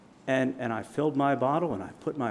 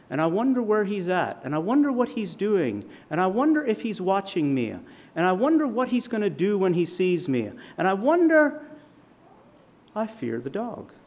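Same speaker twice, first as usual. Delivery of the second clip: building sermon; 6 semitones higher than usual. Acted. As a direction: build the fear line by line, climbing to a peak. Preacher building on fear.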